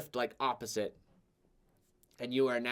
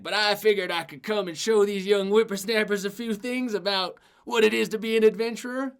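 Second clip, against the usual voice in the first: put-on higher, hearty old braggart; boastful old adventurer; 7 semitones higher, 6 dB louder than usual. Retired adventurer sizing up his new partner, hearty. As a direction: size up the pipsqueak with a chuckle. Tuck voice.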